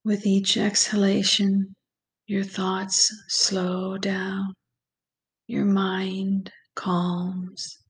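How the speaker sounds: slow, calm meditation voice with long pauses; guided meditation. Acted. Guide leads listeners through breathing and visualization, slow meditation voice. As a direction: ease the listener gently into relaxation, unhurried and even.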